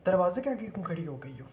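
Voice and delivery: normal talking voice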